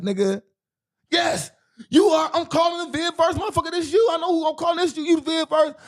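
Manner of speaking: high loud gotcha, pressing hard; heated accusation. Retold or imitated. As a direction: shout him down, you caught him. Insisting to troll on video.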